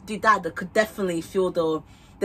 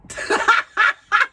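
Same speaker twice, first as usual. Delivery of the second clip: loud laugh; 8 dB louder than usual. Real, not acted. Wig fringe poking her eye.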